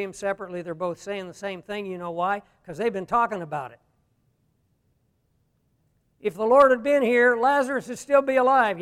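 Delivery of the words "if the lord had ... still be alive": loud, higher-pitched acted lament; complaining sister. Grieving sister talking it over with the other, loud complaint. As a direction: push it up and out, as an aggrieved complaint. Imagined grumbling of the two sisters.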